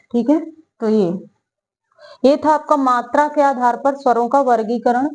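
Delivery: usual speaking voice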